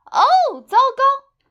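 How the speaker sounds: put-on very high, loud boy's cry; exclaiming. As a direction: squeal it like a panicked kid. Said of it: "艾米的弟弟叫道".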